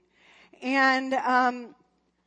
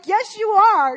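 insistent retort; 9 semitones higher, 8 dB louder, slower than usual. Retold or imitated. Wife retorts in argument.

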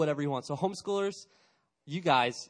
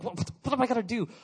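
mock freak-out; 7 semitones higher, faster than usual. Student freaking out.

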